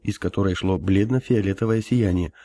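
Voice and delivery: usual speaking voice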